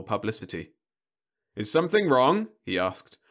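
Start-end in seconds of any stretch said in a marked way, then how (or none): 1.5-2.5 high, loud cheery reassurance; robot salesman voice. Acted. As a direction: soothe by rote like a machine. Robot service feature.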